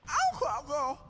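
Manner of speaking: high playful catcall. Retold or imitated. Creator imitates a cut line, a high cheeky catcall. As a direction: squeal it out like a cheeky shout-out. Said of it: "she's like".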